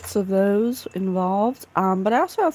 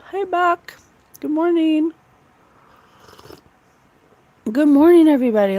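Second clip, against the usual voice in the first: high, loud cheery hello; bright greeting; 7 semitones higher, 6 dB louder, faster than usual. Real, not acted. Greeting her livestream viewers, high and loud cheer. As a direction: greet them big and happy.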